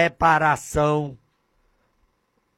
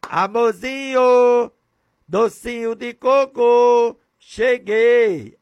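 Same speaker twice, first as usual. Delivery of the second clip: higher, louder, slow syrupy coo; sugary husband voice; 7 semitones higher, 6 dB louder, slower than usual. Acted. Preacher playing a husband cooing to his wife at the gate. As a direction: put on a syrupy sweetheart voice. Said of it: "Aí eu".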